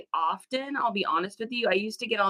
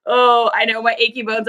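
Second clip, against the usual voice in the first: ordinary delivery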